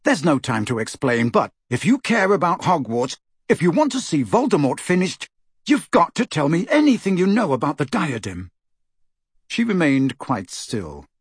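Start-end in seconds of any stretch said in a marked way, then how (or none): 0.0-8.5 urgent, higher-pitched insistence; fierce. Acted. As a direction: press fiercely for an answer. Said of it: "Harry said fiercely".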